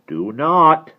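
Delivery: loud, high, sharp warning; sudden forceful order; sharp command. A narrator's reading. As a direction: forbid it sharply. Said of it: "said the Duro sharply".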